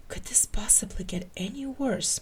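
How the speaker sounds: voiceless despairing whisper; murmured. A narrator's reading. Mulder, watching things get worse, whispers to himself. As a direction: sigh it under your breath. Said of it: "Mulder murmured".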